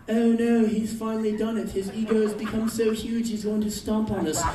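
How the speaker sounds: loud hammed-up alarm; mock panic. Tiny English people seeing the shoe, mock panic.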